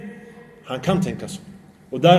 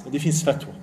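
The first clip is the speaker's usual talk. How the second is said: ordinary talk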